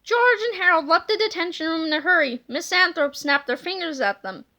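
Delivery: usual speaking voice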